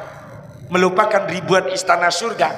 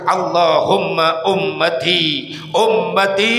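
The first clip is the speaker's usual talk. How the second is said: trembling whispered prayer; slower than usual. Acted. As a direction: murmur it slowly like a prayer through quivering lips. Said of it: "bibir Rasul bergetar".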